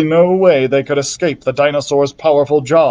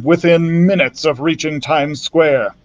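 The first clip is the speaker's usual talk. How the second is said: ordinary delivery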